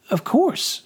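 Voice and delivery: high-pitched lady's reply; noblewoman. Acted. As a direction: answer as a lady, voice lifted well up. Lady replies to the Major.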